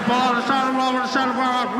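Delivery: muffled, barely intelligible cop orders; irritated police loudspeaker. Retold or imitated. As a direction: run the words together like a cop on a bad speaker. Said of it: "I don't know what the hell that guy was saying".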